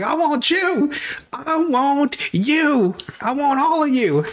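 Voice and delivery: high, drawn-out Uncle Sam recruiting call. Retold or imitated. Apollo as Uncle Sam calling the crowd, high and drawn out. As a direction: boom it like the Uncle Sam poster. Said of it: "changes outfits into Uncle Sam".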